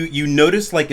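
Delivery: ordinary delivery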